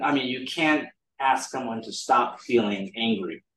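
ordinary talk

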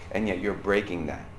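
normal talking voice

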